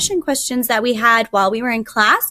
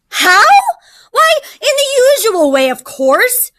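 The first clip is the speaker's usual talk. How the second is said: loud, much higher, exasperated tiger; scoffing tiger; 11 semitones higher, 6 dB louder than usual. Acted. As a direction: scoff at such a silly question.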